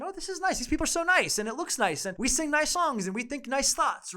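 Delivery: high, sing-song gushing over how nice it all is; gushing naive visitor. Retold or imitated. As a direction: play the wide-eyed newcomer, charmed by everything. Imagined naive visitor charmed by Mormons.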